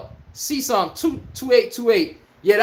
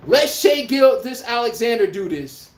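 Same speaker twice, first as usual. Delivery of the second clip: ordinary talk, nothing marked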